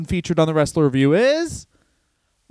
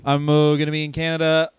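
ordinary talk

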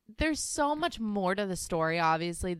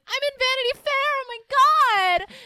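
mock giddy squeal, much higher and louder; giddy teen; 9 dB louder than usual. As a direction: gush like a thrilled teen. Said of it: "She's like".

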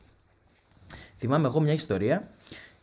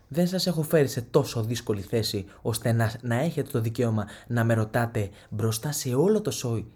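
usual speaking voice

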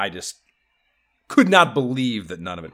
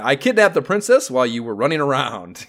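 higher, louder mock-haughty letter reading; condescending; 5 semitones higher, 6 dB louder than usual. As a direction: talk down to the hero, hammed up. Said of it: "there's great condescension at the opening there".

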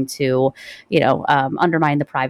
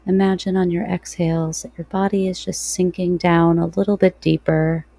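guided meditation. Real, not acted.

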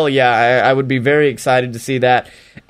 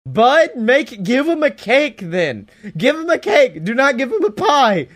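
high, pleading exasperation; exasperated; 9 semitones higher than usual. Retold or imitated. The speaker scolds the writers over the pie, exasperated and high. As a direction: beg them to just use a cake. Exasperated answer to Twitter explanation.

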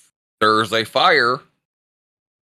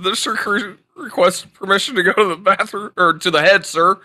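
barked military recruit reply; 7 semitones higher than usual. Retold or imitated. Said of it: "I said".

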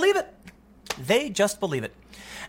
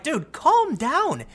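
very slow, higher, drawn-out calm down; exasperated; 8 semitones higher, much slower than usual. Retold or imitated. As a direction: drag it out, weary and dismissive. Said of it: "I'm like".

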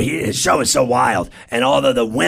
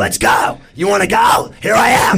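retold shout, climbing higher; fight challenge; 6 semitones higher, 3 dB louder than usual. Comic re-enacts squaring up to his rival, challenging shout. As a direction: goad him into a fight, voice rising.

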